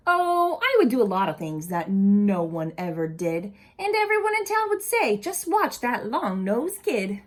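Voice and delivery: lively storybook read-aloud voice; animated picture-book reading; reading to children. Acted. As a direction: read it to a group of kids with expression.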